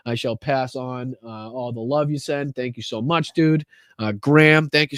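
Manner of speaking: usual speaking voice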